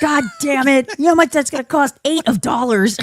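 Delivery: high, shouted cheapskate dad; angry dad. Pretend. Dad complaining about the hospital bill, high mock shout. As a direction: yell about the cost like a stingy father.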